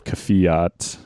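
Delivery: Kvyat said with an F, "kuh-fee-AHT"; F sound in Kvyat. Retold or imitated. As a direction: turn the V of Kvyat into F. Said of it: "say more the F".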